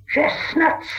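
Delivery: high unvoiced hiss; sneering creature hiss; Gollum hiss. A narrator's reading. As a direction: spit the answer out. Gollum answers riddle.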